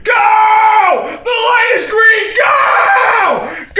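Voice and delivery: full-force, high, desperate shout; frantic drawn-out yelling; screaming in traffic. Acted. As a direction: scream at the cars to move. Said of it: "I'm screaming in traffic".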